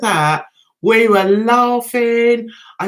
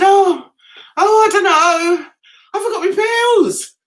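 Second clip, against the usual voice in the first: high, louder flustered cry; flustered panic; 8 semitones higher, 5 dB louder than usual. Retold or imitated. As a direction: blurt it out in a fluster. Panicking answer to the seatmate.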